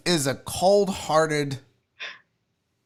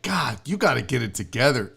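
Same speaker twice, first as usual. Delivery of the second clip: low, softly voiced exasperated wife; retold rebuke, pitched down; unmoved rebuke; 5 semitones lower, 4 dB quieter than usual.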